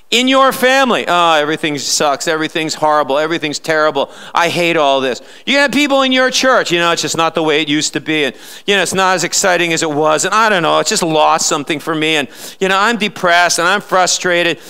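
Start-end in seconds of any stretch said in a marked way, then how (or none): 1.1-5.2 lower, mopey complaining churchgoer; Laodicean voice. Retold or imitated. Preacher imitating a jaded family member, lower mopey whine. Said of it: "that is the Laodicean voice". 6.7-14.6 lower, mopey complaining churchgoer; Laodicean voice. Retold or imitated. Preacher imitating a jaded family member, lower mopey whine. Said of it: "that is the Laodicean voice".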